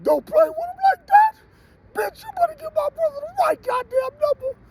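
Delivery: high falsetto sister scolding; mock sister voice. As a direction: squeak it out like an angry sister.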